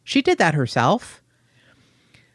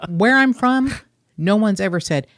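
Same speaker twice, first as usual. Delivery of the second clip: ordinary talk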